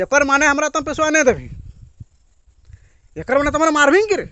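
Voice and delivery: loud, high, fast mock threat. Man in money dispute threatening loudly. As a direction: shout the threat in dialect, quick. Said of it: "तो जोर से बोलता है".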